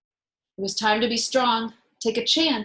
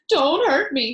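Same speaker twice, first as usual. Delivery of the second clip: louder pleading whimper; 5 semitones higher, 7 dB louder than usual. Acted. The terrified lion pleads with the little mouse. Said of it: "he whimpered".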